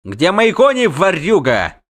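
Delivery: high, loud accusation; angry bellow. A narrator's reading. Halfling demands horses from the thief, barking angrily. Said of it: "рявкнул Дайенти".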